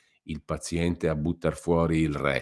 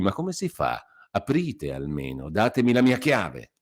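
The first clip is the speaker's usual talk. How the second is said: higher, brisk scolding with mock cheer; lively reproach; 6 semitones higher than usual. A narrator's reading. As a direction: lay into him, almost gleeful. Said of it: "continuò allegramente". (il curato rimprovera Renzo, vivace e ironico)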